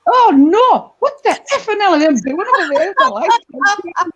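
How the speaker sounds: high, loud acted-out horror; shocked outcry. Bestie's husband seeing the neighbours, shocked outcry. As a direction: mimic an appalled cry of disbelief.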